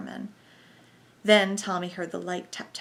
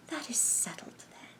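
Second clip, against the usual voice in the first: hushed, hissing voice; quiet sibilant whisper; soft sibilant whisper; 5 semitones higher, 6 dB quieter than usual. A narrator's reading. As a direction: let the s sounds hiss softly. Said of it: "said the sibilant tones".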